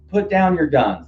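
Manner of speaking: just remembering the words, a half-voiced call; recalled quote. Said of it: "He said, he said".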